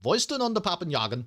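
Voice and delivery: high, mock-German warm-up line; German accent. Shown as a demonstration. Streamer warming up to play a football manager, German accent. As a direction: swing into a put-on German accent. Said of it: "when you have to do an accent".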